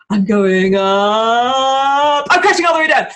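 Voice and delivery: slow rise, then loud high crash; building crash. Shown as a demonstration. Demonstrating the final crash, slow then loud. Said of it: "not supposed to go like".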